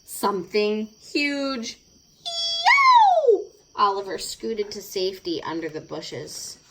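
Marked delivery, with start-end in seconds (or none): 2.2-3.4 very high, loud screeching yowl; startled cat yowl. Acted. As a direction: shriek like a cat frightened by an owl.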